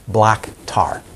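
normal talking voice

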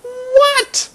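high, loud squeak of disbelief; dumbfounded. Retold or imitated. Teacher imitating his master's dumbfounded reaction to a student. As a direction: blurt it out utterly dumbfounded. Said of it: "He's like, dumbfounded".

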